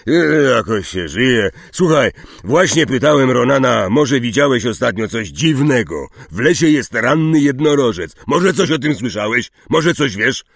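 forceful, eager questions; urgent Hagrid. Acted. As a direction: press a new arrival for news.

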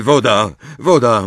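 usual speaking voice

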